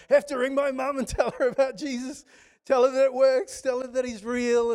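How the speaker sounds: high, loud eager rush; bursting to tell her mum; excited girl. Retold or imitated. Preacher voicing girl eager to call her mum, excited.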